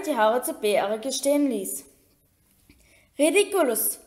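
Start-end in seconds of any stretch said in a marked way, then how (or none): 3.2-4.0 higher-pitched, restrained call. A narrator's reading. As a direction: call out the spell without shouting. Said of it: "rief Seamus". (verhaltener, hoher Ruf)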